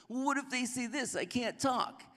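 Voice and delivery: high, whining voice; whiny Moses. Retold or imitated. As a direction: whine it like a reluctant kid. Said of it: "He keeps whining".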